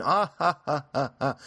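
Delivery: high, loud laugh at his own bit; amused laugh. Real, not acted. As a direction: cackle at the joke just made.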